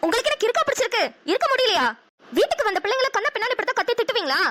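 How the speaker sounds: wife's heated reproach; angry scolding. Acted. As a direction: berate a husband indignantly. Wife berates husband for yelling.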